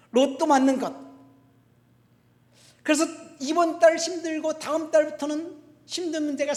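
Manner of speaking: loud, high preacher's delivery; forceful preaching. Real, not acted. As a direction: drive it home to the congregation.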